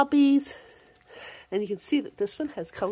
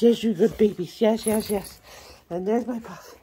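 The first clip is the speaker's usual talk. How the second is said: ordinary talk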